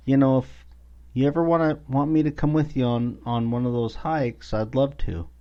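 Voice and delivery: high, lightly pleading mom voice; imitating mom. Mom's request quoted while storytelling.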